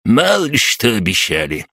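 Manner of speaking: high-voiced old codger who won't let up; stubborn old man. A narrator's reading. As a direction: argue back like a cantankerous old man. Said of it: "не унимался старикан". (упрямый старикан не унимается)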